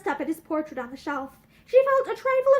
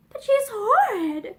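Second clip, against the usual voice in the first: eager; 6 semitones higher, 3 dB louder, slower than usual. A narrator's reading. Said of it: "she said eagerly".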